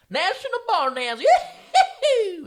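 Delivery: high, loud country whoop; hammed-up yee-haw. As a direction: yell it like a cowboy hoedown. Hamming up barn dance.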